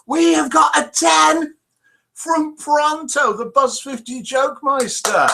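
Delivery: high, loud, proud announcement of a ten; excited announcement. Real, not acted.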